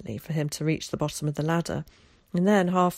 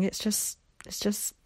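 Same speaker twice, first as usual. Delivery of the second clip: halting, higher and softer; squirming; 4 semitones higher, 4 dB quieter than usual. A narrator's reading. Embarrassed boy struggles to explain, squirming. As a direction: stall awkwardly, repeating yourself. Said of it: "said Will, squirming".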